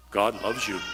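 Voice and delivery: low, bland, dutiful; routine church phrase. Retold or imitated. Preacher mimicking the routine churchy line, low and bland. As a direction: say it like a tired church cliché. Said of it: "you hear in church so many times".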